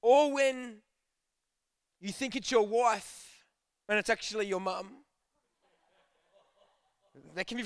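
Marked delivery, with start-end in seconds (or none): none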